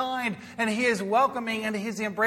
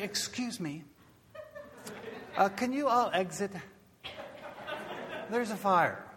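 soft, hesitant, overly polite; mild polite request; 8 dB quieter than usual. Pretend. Preacher mocking a timid fire warning, softly polite. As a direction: politely ask them to leave, almost apologetic. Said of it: "Do you think I'd say".

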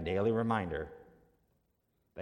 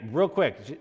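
ordinary talk, nothing marked

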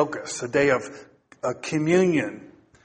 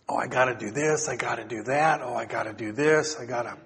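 low, soft inner-voice fretting; mock fretting; 5 semitones lower, 6 dB quieter than usual. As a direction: grumble a to-do list to yourself, quietly. Voicing guilty nagging inner thoughts.

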